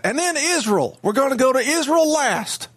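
hammed-up Southern senator lecturing a class; Lindsey Graham. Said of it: "that's how Senator Graham would".

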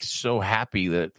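ordinary talk

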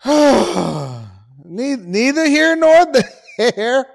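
laughing. Real, not acted. Laughing repeat of phrase.

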